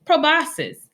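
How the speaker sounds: Proboscis lifted high and set apart as a headword; vocabulary word announced. Shown as a demonstration. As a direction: present Proboscis like a teacher. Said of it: "Look at these words we can learn".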